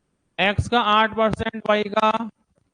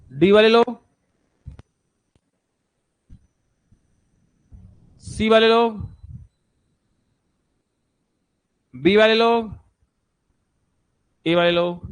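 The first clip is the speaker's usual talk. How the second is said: calling out; 3 dB louder than usual. Real, not acted. Teacher calls out to the class.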